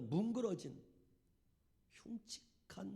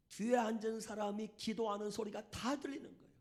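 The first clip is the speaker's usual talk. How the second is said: normal talking voice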